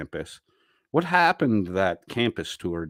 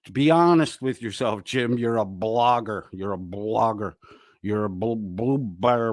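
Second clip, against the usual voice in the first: normal talking voice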